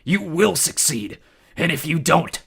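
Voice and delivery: loud slow menace; threatening. A narrator's reading. Ares threatening.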